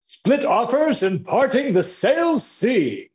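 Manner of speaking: loud, high, drawn-out grand title announcement; aristocratic gusto. Acted. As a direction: proclaim it slowly with pompous aristocratic gusto. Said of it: "say it with an aristocratic accent".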